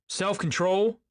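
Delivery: high, loud echo rising into a question; incredulous. A narrator's reading. Tom echoing Daisy's plea, incredulous. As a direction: throw it back in disbelief, voice jumping up. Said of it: "repeated Tom incredulously".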